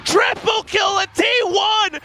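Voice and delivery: shouting a triple kill, high; big hype call, full voice; excited. Real, not acted. Caster calls a triple kill.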